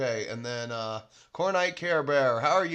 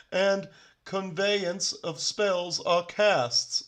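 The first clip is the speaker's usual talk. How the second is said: slow, higher-pitched mock British reading; put-on British accent; 4 semitones higher, much slower than usual. Host reading a puzzling rule to viewers, slow mock British accent. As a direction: draw it out in a hammy British accent. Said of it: "Should I do my British accent for this?".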